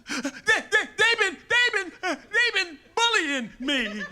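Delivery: put-on high, loud, slow blubbering kid; crying kid. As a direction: stammer it out like a sobbing schoolboy. Bullied kid voiced crying.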